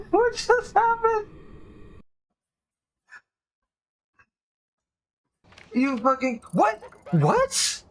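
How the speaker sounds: high baffled cries; stunned, can't believe it; shocked disbelief. Real, not acted. Baffled after ghost kill.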